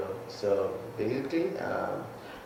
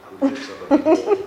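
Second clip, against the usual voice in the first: mock threat bursting into laughter, much higher and louder; laughing threat; 7 dB louder than usual. Real, not acted. Guest jokingly warns anyone who uses his middle name, laughing. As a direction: laugh your way through a playful warning.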